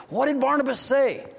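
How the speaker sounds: eager question. Acted. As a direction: press for the answer. Zacchaeus eager for the answer.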